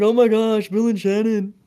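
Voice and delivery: high, gushing mock greeting of the parents; gushing girl voice. Girl greets the parents in a booth, high and gushing. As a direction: squeal it like an overexcited old family friend. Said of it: "they're like".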